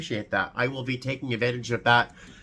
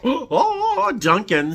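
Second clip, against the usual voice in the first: gasp of surprise; 13 semitones higher, 4 dB louder, much slower than usual. Real, not acted. Opening a surprise gift card.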